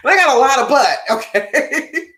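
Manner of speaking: laughing through a cheeky joke. Real, not acted. Joking about her butt.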